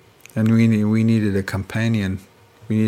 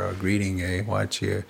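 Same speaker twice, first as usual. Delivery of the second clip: ordinary talk, nothing marked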